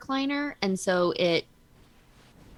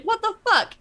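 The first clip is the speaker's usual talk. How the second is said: retold startled outburst; 7 dB louder than usual. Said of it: "I was just surprised".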